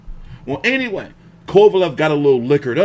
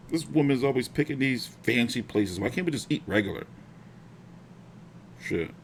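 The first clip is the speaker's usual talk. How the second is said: soft complaining mutter; grumbling; 10 dB quieter than usual. Real, not acted. Man complaining about his partner's fancy dinner picks, muttered. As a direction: grumble it to yourself.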